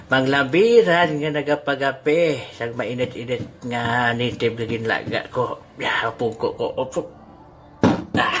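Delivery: ordinary talk, nothing marked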